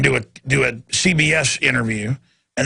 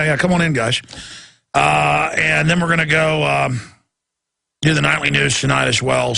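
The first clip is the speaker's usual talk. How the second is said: ordinary talk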